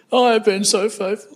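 a hint of a self-pitying prophet; hammed-up self-righteous voice. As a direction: lightly ham up a smug, wounded believer. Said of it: "I hammed the voice up a bit".

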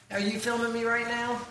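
ordinary talk

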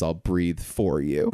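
low slow earnest vow; lightly voiced hero, low and slow; slow low Costner line. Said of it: "Costner goes".

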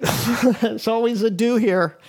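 ordinary talk, nothing marked

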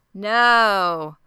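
long, drawn-out mock "no"; loud, stretched, unemotive refusal; dramatic but emotionless. Boy sweeping candy off the table, dramatic yet emotionless. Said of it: "most dramatic, but also the least emotive way possible".

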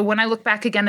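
ordinary delivery

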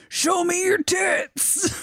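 high, goofy mock holler; weird voice. Interviewer asking band about dudes, imitated in weird high voice. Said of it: "in this weird voice".

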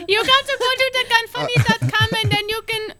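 very high Mexican attempt sliding into Indian; squeaky, badly faked Mexican accent that sounds Indian; botched Mexican accent. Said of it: "that's freaking Indian".

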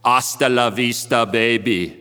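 put-on low Austrian action-hero accent; amateur Austrian-accent impression of a film catchphrase; Arnold Schwarzenegger. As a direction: deliver it as a cool Austrian movie star. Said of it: "in my interpretation of his voice and, um, accent".

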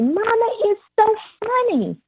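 put-on very high, gleeful little-girl voice; imitated giggly toddler. Toddler at the table to mama, mock hysterical glee. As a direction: mimic a toddler tickled by something, squealing high. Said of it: "she'd just start laughing uncontrollably".

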